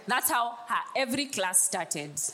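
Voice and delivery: ordinary delivery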